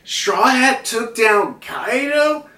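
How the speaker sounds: disbelief. Retold or imitated. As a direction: ask it in slow disbelief. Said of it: "Then we got Apu reacting to this, like".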